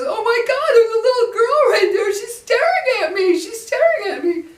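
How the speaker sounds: very high, loud hysterical shriek; imitated freaking out. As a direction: imitate a son shrieking in terror. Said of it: "he was freaking out".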